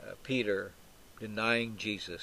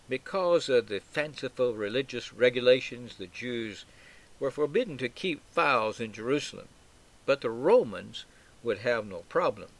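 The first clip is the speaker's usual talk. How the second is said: normal talking voice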